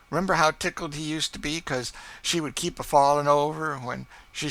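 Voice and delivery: high, loud, delighted reminiscing; tickled by the old memory; Chloe's fond dialect voice. Acted. Chloe recalls her baby daughter to her mistress, high delighted voice.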